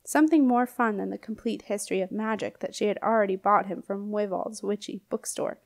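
usual speaking voice

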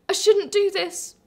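slow and high, trying to stop the tears; choked self-reproach; tearful, slowing down. Acted. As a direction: rein it in through the crying. Pulling herself back after crying.

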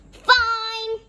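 loud, high, mildly huffy concession; lightly acted grumble; grudging grunt. A narrator's reading. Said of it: "Louis grunted".

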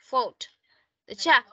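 ordinary delivery

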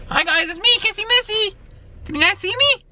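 high put-on girly character voice; Kissy Missy voice. Wearing the mask, he greets viewers in a high Kissy Missy voice. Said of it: "I'm gonna wear the Kissy Missy mask".

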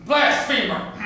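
high, loud denunciation; accusing shout. Acted. Father's rant over his child, loud accusing cry. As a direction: hurl the word out as a condemnation.

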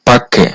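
পাক্যে with a doubled ক sound; Korean pronunciation demo. Shown as a demonstration. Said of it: "এই শব্দটার সঠিক উচ্চারণ হবে".

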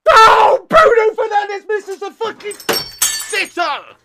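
high, loud cry of disbelief; agonised shout. Real, not acted. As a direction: wail at a missed chance. Live reaction to a missed sitter.

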